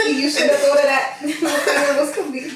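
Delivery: laughing. Real, not acted. Laughs on line.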